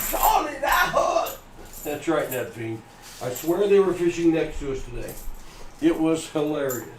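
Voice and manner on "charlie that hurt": high, shouted mock British boy; British child accent. Host replaying the viral clip, shouted British boy accent. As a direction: cry out like the hurt little British boy. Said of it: "They had the strongest British accent".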